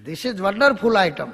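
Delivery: slow, drawn-out mock English wonder; mock English marvel. Englishmen marveling at the bhajiya, slow mock English accent. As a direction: savour each word like an amazed foreigner.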